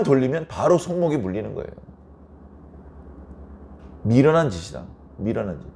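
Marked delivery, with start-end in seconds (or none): none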